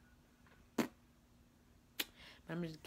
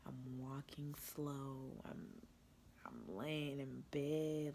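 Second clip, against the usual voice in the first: ordinary delivery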